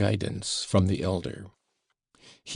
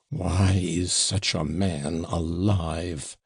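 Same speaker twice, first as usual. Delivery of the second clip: slow, hollow growl, lightly voiced; much slower than usual. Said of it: "growled in a hollow voice".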